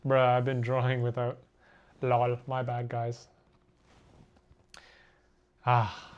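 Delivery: ordinary talk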